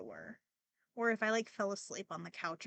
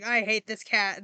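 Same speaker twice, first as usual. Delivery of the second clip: a loud mock grumble from dad; retold loud outburst; 10 dB louder than usual. Dad complaining about the new cat, mock loud. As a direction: belt it out like a grumpy dad.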